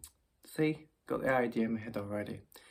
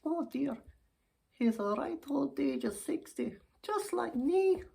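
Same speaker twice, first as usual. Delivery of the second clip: put-on high voice of a sixty-year-old dear; chatty old lady. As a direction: play a pleased old lady, pitched up. Old lady gives his age.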